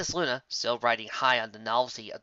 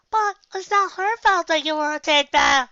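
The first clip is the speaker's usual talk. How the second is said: much higher and louder filly voice, cut off; Apple Bloom interjecting; 8 dB louder than usual. Acted. Apple Bloom cutting in at the table, high and loud. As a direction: blurt it as the little sister. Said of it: "interjected Apple Bloom".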